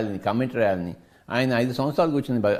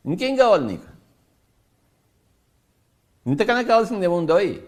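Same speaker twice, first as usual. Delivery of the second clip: preaching at full force; 5 semitones higher than usual. Acted. Teacher scolding a listener who cries over nothing, fervent preaching. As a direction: hammer home what they already have.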